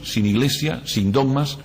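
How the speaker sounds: ordinary delivery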